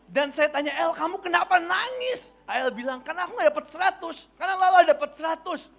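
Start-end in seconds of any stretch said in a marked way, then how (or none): 0.8-2.4 little sister's question. Retold or imitated. Preacher voices crying little sister. 3.0-5.7 crying little sister. Retold or imitated.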